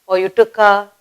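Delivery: usual speaking voice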